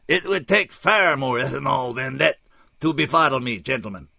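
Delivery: put-on high, loud, oddly accented alien; formal protest in an unplaceable accent; stilted foreign-accented English, pitched high; unplaceable funny accent. A narrator's reading. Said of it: "that funny accent I couldn't place".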